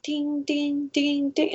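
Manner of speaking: high, light sing-song dings; imitating phone pings. Mimicking her phone pinging with his messages, high sing-song. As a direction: mimic the phone chiming over and over. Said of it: "and my phone's like".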